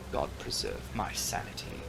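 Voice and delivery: plain reading voice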